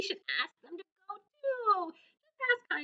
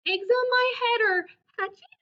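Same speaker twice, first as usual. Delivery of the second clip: high, rising, panicked question; alarmed Gerald; 9 semitones higher, 4 dB louder than usual. Acted. Gerald learns the eggs are hatching, high and alarmed.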